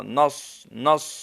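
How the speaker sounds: نص clipped, the ر dropped; wrong pronunciation. Shown as a demonstration. Teacher mimics students who drop the ر in نص. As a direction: cut نص short, dropping the ر. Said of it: "نص نہیں".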